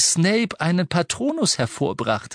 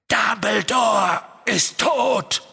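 hurled shout; 8 semitones higher, much slower than usual. Acted. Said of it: "schleuderte Harry die Worte entgegen".

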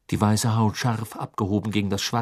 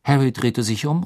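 ordinary delivery

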